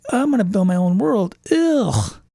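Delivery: same line ending in a groaning ugh; weary world builder. Retold or imitated. Game master dreading building his own world, mock weary groan. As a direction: dread at all the work ahead. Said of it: "I'm like".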